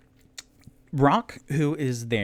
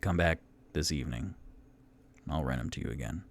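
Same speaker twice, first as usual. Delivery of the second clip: gruff innkeeper; 7 semitones lower, 6 dB quieter, much faster than usual. Acted. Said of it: "Durnan goes".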